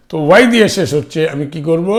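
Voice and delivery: normal talking voice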